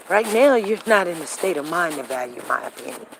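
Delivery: usual speaking voice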